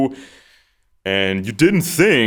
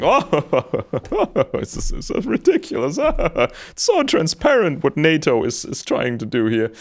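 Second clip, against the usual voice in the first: mocking laugh, pitch jumping high; laughing scorn; 7 semitones higher than usual. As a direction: scoff through a laugh as if it is absurd. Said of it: "And Putin is just laughing".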